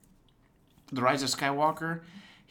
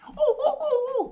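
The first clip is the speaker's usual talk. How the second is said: high squealing droid cries; R2 whistle imitation; 5 dB louder, slower than usual. Imitating R2 for a co-host. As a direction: squeal like a little droid doing a flip. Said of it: "One of my favorite is when he's like".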